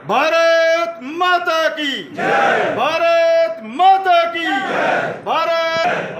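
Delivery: high, slow, drawn-out rallying cry; rally the crowd at full voice; slogan shouting. Real, not acted. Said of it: "मैं दो तीन नारे लगाऊंगा".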